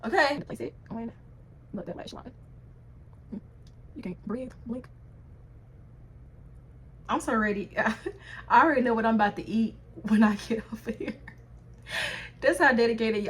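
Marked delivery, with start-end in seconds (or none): none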